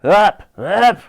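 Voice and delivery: Lady Buckshot hollers orders to her pilot, loud; hollering. A narrator's reading. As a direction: bellow at the pilot. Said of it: "Lady Buckshot hollered".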